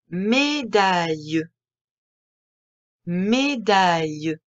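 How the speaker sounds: "Médaille" said very slowly and evenly, every syllable clear; slow dictation. Shown as a demonstration. Teacher dictating a word list to a child, very slow. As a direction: drag "Médaille" out for a child to catch. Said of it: "Première activité".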